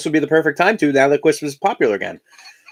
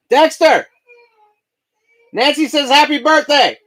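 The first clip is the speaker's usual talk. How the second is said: calling out; 13 semitones higher, 5 dB louder, slower than usual. Real, not acted. Shouting to child across house.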